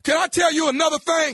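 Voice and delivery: fast, loud and high; shout. Acted. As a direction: fire it off at him. Caller shouting at target.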